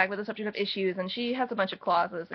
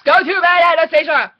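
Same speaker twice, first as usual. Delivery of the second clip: loud, high mock yell; full-volume shouted order; all-caps shout; 8 semitones higher, 13 dB louder than usual. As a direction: bellow it like an angry all-caps email. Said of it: "all caps".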